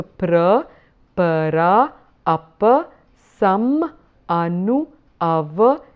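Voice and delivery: each prefix said separately; slow, separated teaching recitation of Sanskrit prefixes; broken up. Shown as a demonstration. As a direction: list them one at a time with small pauses. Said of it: "look at the breakup of the sound".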